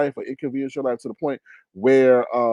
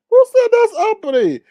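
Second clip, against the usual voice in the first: much higher, louder outraged repeat; indignant yell; 7 dB louder than usual. Real, not acted. Host demanding of chat who called it uppity, indignant yell. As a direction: throw the question out in outrage. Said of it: "repeats the question louder".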